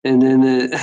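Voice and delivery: ordinary talk, nothing marked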